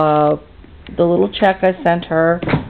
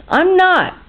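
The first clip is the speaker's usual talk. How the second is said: high, emphatic protest; indignant refusal; 11 semitones higher than usual. Real, not acted. Customer telling museum off over refused check, indignant refusal. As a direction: dig in, voice jumping up.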